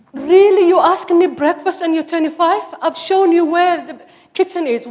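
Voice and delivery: loud, high, teasing exasperation; mock outrage. Mother teasing her grown son about breakfast, loud mock outrage. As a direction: scold him like he's twelve, half joking.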